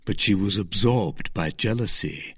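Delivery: ordinary delivery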